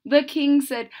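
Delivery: ordinary delivery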